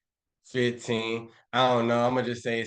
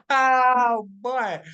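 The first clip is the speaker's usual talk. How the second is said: amused exclaim; 7 dB louder than usual. Real, not acted. Streamer cracking up over a chat message, laughing high and loud.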